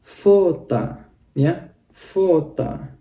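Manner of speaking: fota said slowly, stress on fo, final a; slow fota, the final O read as A; Russian pronunciation demo. Shown as a demonstration. Teacher showing students the reading fota, slow and clear. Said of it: "kita bacanya jadi A".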